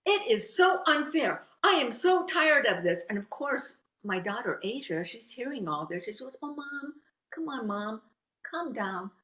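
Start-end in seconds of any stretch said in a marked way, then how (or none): 0.0-3.0 loud, higher-pitched venting; retold rant, emphatic and indignant; angry spouting. Mother venting in the car after being skipped in line, angry spouting. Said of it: "I'm just spouting". 6.4-9.1 softly voiced pleading child; lightly voiced daughter calming a parent; soothing daughter. Daughter to her mom in the car, soft soothing plea.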